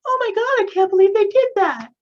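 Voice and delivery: very high, loud mock disbelief; hammed-up falsetto outcry; people acting shocked. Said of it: "who had a voice like that?".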